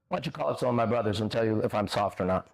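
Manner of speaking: ordinary talk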